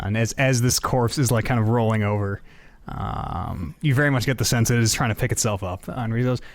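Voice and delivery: usual speaking voice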